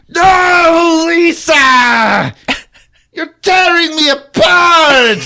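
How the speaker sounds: high, loud anguished yell, slow; hammed-up scream. Sam screaming at Lisa over the phone, anguished. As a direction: wail it like a melodrama.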